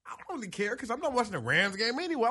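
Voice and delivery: usual speaking voice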